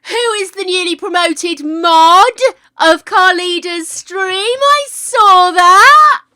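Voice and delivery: high, very loud, drawn-out excited shout; overexcited congratulations. Real, not acted. Streamer congratulating a newly promoted mod, overexcited shout. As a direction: shout it across the room, thrilled. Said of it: "Sorry, got overexcited there".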